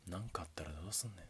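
put-on soft whispered confession to a sleeping girl; tender whisper. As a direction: murmur it so you don't wake her. (寝顔に向けた優しいささやき)

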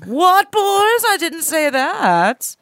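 high, drawn-out mock innocence. Playing the singer denying it to bandmates, high mock-innocent protest. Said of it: "and [name]'s like".

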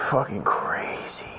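slow, breathy whisper; amazed whisper. Real, not acted. Looking back on his own wild story, amazed whisper. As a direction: whisper it slowly in disbelief.

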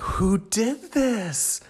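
mock astonished question, voice up high; mock bewildered. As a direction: act baffled at your own clean kitchen. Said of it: "And I'm like".

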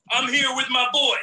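ordinary delivery

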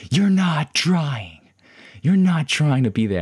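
imitating an angry counselor's yell; snapping. Counselor losing his temper at a truant student, snapping. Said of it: "snaps and he goes like".

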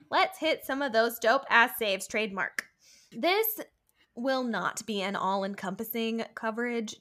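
usual speaking voice

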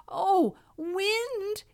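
high, breathless cry; gasping plea. A narrator's reading. Medio Pollito begging the wind, gasping. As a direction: gasp it out in a high little voice. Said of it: "he gasped".

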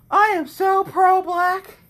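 sarcastic mock pride. Sarcastic high mock claim.

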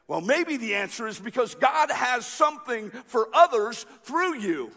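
energetic, projected sermon delivery; preacher's rising-and-falling emphatic cadence; emphatic preaching. Real, not acted. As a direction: drive the lesson home to a congregation. Preaches the lesson with force.

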